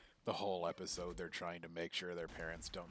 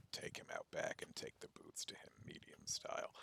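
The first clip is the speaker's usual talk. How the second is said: low, softer whispered order; menacing whisper; 6 dB quieter than usual. Retold or imitated. Manager quietly orders the guard to beat him, whispered. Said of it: "He's like".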